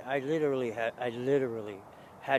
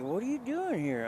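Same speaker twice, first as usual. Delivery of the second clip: surprised question; 9 semitones higher than usual. Retold or imitated. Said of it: "he's like".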